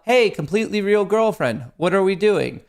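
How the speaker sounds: called out high and loud across the house; calling out. Retold or imitated. Streamer yelling out to his girlfriend, high and loud. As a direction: shout it to the girlfriend in the next room. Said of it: "I yell out".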